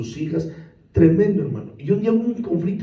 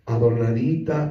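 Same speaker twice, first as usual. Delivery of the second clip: Adornadita drawn out slowly and low; drawn-out lower adornadita; 7 semitones lower, slower than usual. Shown as a demonstration. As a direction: linger on Adornadita, lower and slow. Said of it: "¿cómo encontró su casa?".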